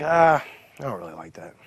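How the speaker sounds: imitating a reluctant boss, voiceless and rough; low-voiced dismissal, almost a whisper; dismissive grumble. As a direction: grumble it without full voice. Bert voiced rejecting the name.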